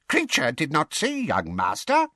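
high-pitched elf voice; servile house elf. A narrator's reading. House elf bowing to young master, servile.

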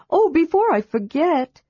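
high, bright kender voice; eager kender. A narrator's reading. Kender questions the dragon, high and eager. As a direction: pitch it up like a curious kender. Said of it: "sadly watching the spectacles".

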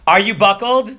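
playing his fussing mother; mom asking. Imitating his mom checking the seatbelt.

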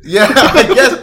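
normal talking voice

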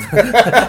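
loud bright laugh, pitched high; real burst of laughter. Real, not acted. Thanked for his Portuguese, he bursts out laughing. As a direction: laugh it off, flattered.

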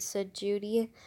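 plain reading voice

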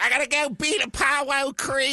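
high-pitched boxer from Jersey; hammed-up Jersey movie boxer; Rocky impression, Jersey accent. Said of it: "Rocky's got this, like, Jersey".

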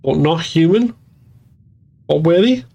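increasingly shaky. A narrator's reading. Holly questioning Hazel about the children, a little shaky. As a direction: voice starting to waver on anxious questions. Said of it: "her voice getting shakier".

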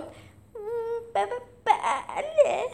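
high, stuttered yes; stammer, then squeak out a drawn-out answer; nervous stammer. A narrator's reading. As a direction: falter on the first sound, then jump high and slow. Stammered reply to a threat.

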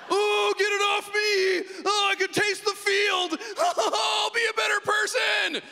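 high, slow disgusted shouting; Vladimir Putin impression. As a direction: shriek in revulsion, laughing through it. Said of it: "Vladimir Putin being forced to eat out a horse's butthole".

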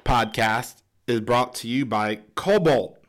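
hammed-up commercial announcer; ad read. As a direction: sell the pliers like a TV ad.

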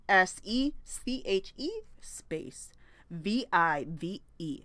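slow, letter by letter spelling for viewers; spelling it out slowly. Shown as a demonstration. Spelling the brand name slowly for viewers. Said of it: "this is a Sèche Vive".

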